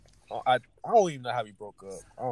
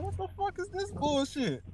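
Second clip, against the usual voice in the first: high indignant quote; 6 dB quieter than usual. Retold or imitated. Quoting his angry reaction.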